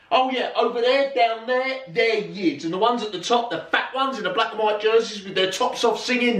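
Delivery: retold dad, strained high as if over a crowd; high animated explaining. Dad pointing out fans to his child, high animated explaining. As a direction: voice pitched right up, reeling off examples.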